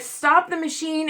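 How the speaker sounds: mild mock yell, high-pitched; retold scream. Patient begging techs to stop the scan, mild retold scream. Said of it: "I was screaming bloody murder for them to stop".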